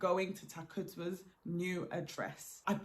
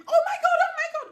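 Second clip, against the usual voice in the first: very high, loud shrieking panic; woman screaming at a dark figure barging in; terrified scream; 10 dB louder, much faster than usual. Retold or imitated. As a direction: scream in fright at a stranger in the doorway.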